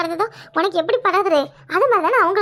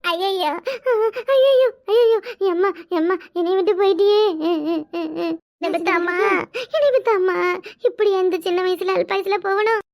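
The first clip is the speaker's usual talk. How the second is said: put-on wailing. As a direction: launch into a fake lament for mother. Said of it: "அழுவ ஆரம்பிக்கணுமா". (போலியான ஒப்பாரி அழுகை)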